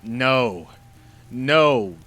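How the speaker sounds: loud, hard no; very loud genie no; adamant refusal. Acted. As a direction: slam the door on his plea. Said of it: "returned the genie, persisting".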